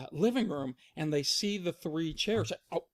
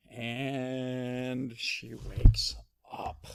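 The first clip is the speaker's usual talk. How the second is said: slow, drawn-out storytelling; suspenseful narration; 2 semitones lower, slower than usual. A narrator's reading. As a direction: stretch each phrase towards the waking.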